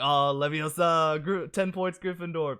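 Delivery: loud, high mock heckling; imitated catcalls. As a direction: yell it like rowdy passersby heckling a costume. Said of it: "People are just yelling at me".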